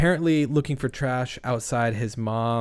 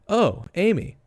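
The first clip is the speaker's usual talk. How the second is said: ordinary delivery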